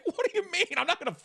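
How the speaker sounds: incredulous outburst. Pretend. Refusing boyfriend's cursed gift.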